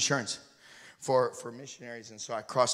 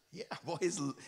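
ordinary talk, nothing marked

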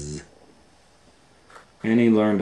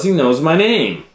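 much higher, louder delighted gasp; amazed lunch lady; 11 semitones higher, 9 dB louder than usual. Acted. Lunch lady amazed the fly says her name, high and delighted. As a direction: marvel that the fly knows her. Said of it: "said Roz".

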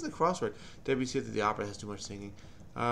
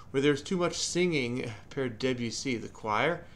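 ordinary delivery

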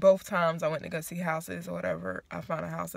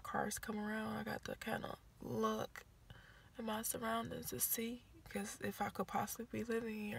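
voice dropping low; 3 semitones higher, 10 dB quieter than usual. Real, not acted. Vlogger hushing while watching cars outside. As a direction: let the voice sink to a hush. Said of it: "my voice is going down".

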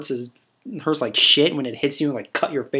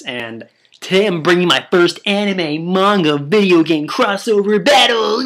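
rising into an excited yell on battle; hyped shout; 5 semitones higher, 4 dB louder than usual. Real, not acted. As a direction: announce it like a hype man, getting louder. Hyped intro announcement.

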